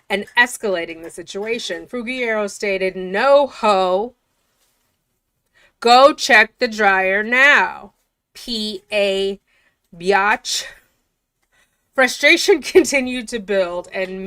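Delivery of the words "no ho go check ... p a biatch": slow, louder mock inmate shouting at the deputy; sassy demand. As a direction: spit each word out like a fed-up inmate. Inmate quoted cursing at deputy.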